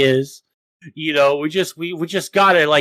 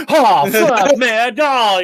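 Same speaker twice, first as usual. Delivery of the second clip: higher, hammed-up startled yelp; mock panic; 6 semitones higher, 5 dB louder, much slower than usual. Imitating Aiden hearing he'll go to jail, hammed-up panic. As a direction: jump up in fake alarm. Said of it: "he's, like, walking around".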